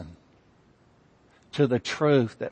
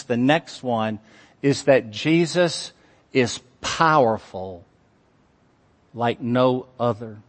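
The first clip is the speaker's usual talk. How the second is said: normal talking voice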